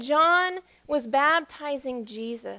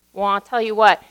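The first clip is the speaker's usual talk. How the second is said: ordinary delivery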